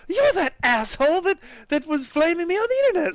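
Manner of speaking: high, loud imagined outrage; mock indignant accusation. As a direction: act out her furious recognition. Acts out her imagined angry accusation.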